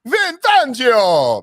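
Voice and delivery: loud, drawn-out hammed-up Italian accent; cartoon Italian shout, slow and high; Mario-style Italian. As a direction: belt the name like Mario. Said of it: "Why am I talking like Mario?".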